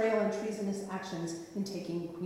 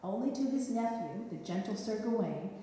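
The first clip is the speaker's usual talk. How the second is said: usual speaking voice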